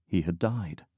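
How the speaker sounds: plain reading voice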